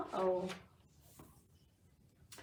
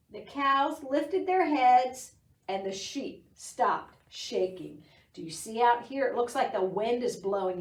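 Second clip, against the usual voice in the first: plain reading voice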